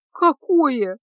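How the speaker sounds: terrified squeak. A narrator's reading. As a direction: squeak it in horror. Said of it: "с ужасом пискнула Феска". (испуганный писк, выше и громче)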